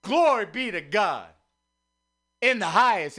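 ordinary talk, nothing marked

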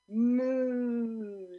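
loud, high, long moo; farmer mooing. Acted. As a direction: moo in joyful surrender. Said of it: "says the farmer".

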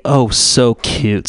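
usual speaking voice

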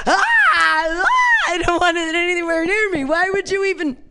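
hammed-up squeamish panic; mock horror. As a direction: back away shrieking in disgust.

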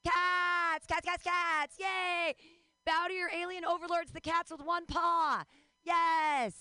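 high, loud, drawn-out hype shout; rally the crowd like cat worshippers; whooping mock-fanatic cheer; over-the-top cheering. Rallying the audience about cats.

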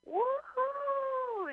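high whooping crowd cheer. Retold or imitated. Imitating the crowd cheering back at the host. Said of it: "the crowd would go like".

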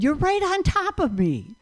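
high-pitched mock complaint; complaining stranger. Man turning on the walker behind him, high complaining voice. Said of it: "he turned and he said".

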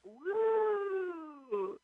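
high cheering crowd whoop; imitated crowd cheer; crowd woo.

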